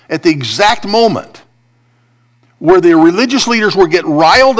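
fervent preaching. Acted. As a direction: drive the point home from the pulpit. Preacher building to a climax.

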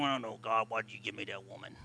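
low, soft grumble to God; mock a fed-up husband muttering; muttered complaint. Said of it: "you stand there going".

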